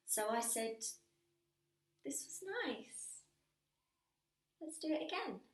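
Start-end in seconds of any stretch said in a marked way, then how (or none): 2.0-5.4 high, lilting invitation; hopeful sweetness. Acted. Woman asking the man to meet again, hopeful and high. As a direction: pitch it up, coaxing for another meeting. Acts out her hopeful parting words.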